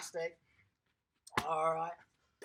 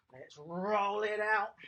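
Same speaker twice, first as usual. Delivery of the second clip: slow; slower than usual. Shown as a demonstration. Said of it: "coach demonstrates rolling".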